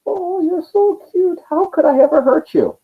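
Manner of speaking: put-on tender baby talk from a hardened general; cooing at a baby. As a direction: melt over the cute newborn.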